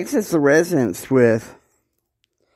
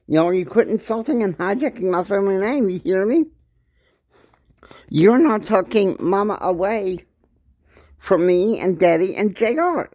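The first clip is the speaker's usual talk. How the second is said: high, fast, indignant tirade in a higher put-on voice; imitated furious yell; 7 semitones higher than usual. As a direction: defend the family name, scolding him hard. Furious woman yelling at man.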